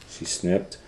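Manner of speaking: plain reading voice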